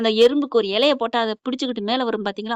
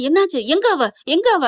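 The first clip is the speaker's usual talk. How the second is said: raised, louder storyteller's urgent demand; urgent questioning; 5 semitones higher, 4 dB louder than usual. Acted. Hero demanding the missing woman's whereabouts, urgent questioning. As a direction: press for an answer, pitch jumping up.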